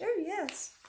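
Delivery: exaggerated posh child voice. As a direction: lampoon a posh little child saying "rather". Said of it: "It's all very posh".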